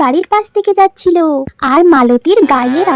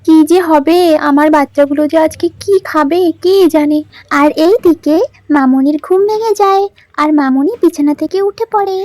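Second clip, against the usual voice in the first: ordinary talk, nothing marked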